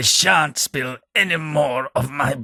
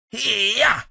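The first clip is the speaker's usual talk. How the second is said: mock battle cry; 13 semitones higher than usual. Battle cry entering a fight.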